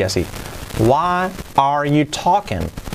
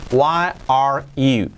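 normal talking voice

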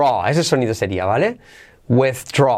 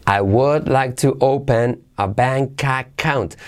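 slow model sentence; much slower than usual. Shown as a demonstration.

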